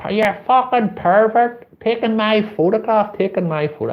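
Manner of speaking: mock girl screaming pervert at him. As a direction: shriek it up high like the enraged girl. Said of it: "She starts fucking screaming".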